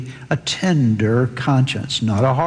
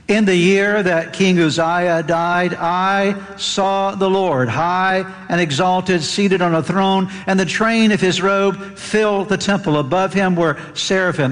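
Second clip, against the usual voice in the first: higher-pitched pulpit scripture reading; 6 semitones higher than usual. Acted. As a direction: read the passage aloud with reverence. Reads Isaiah aloud from scripture.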